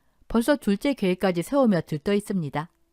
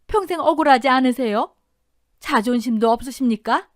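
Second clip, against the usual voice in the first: raised, pressing questions; challenging; 4 semitones higher, 4 dB louder than usual. Acted. Mother needling the betrayed in-law about his pride, challenging. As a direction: goad the listener with pointed questions.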